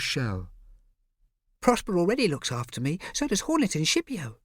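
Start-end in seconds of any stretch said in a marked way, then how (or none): 1.6-4.4 put-on high small child voice; a proud little kid; little boy voice. A narrator's reading. Bo's voice.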